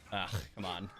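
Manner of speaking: normal talking voice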